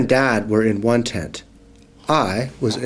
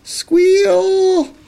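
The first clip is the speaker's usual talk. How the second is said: very high, long-held squeal; imitated squeal; 4 dB louder than usual. A narrator's reading. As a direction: squeal the word on one piercing high note. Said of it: "It sounded like this".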